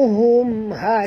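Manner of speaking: ordinary delivery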